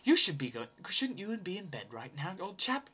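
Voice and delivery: mock posh English accent for a nagging robot; lightly imitated English robot voice. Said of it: "this goddamn robot following us, going".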